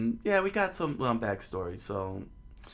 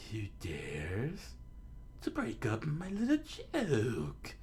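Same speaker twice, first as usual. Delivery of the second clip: Buggy; 2 semitones higher, 6 dB quieter, much slower than usual. Acted. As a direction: draw it out with theatrical outrage. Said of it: "Buggy said".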